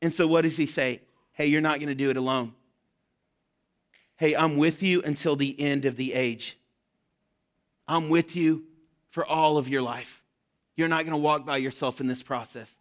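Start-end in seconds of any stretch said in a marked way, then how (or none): 1.3-12.8 softer, lower, gently promising; tender reassurance. Acted. Pastor voices Jesus comforting the church, soft and lower. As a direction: quietly comfort a frightened friend.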